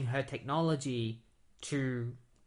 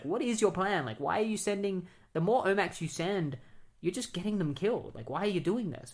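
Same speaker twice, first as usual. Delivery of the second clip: angry demand; 6 semitones higher than usual. Retold or imitated. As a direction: press him hard for answers, pitch up. Diana confronts Brother Eye angrily.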